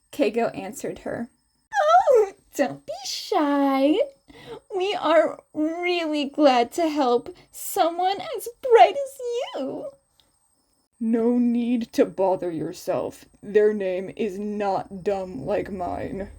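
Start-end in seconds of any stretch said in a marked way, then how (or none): 1.8-9.9 higher, playful teacher voice; higher playful woman's voice; flirty Midnight. Acted. 11.0-16.3 lower, flat tired teacher; a touch lower and drowsy; lightly lower, bored man's voice; bored Shota. Acted.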